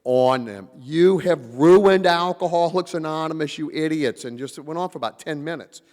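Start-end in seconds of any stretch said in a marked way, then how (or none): 0.8-4.2 loud, raised scolding tirade; retold railing. Al-Anon guest berates German AA members, loud angry tirade. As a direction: tear into them as if they ruined everything. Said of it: "he is railing on them".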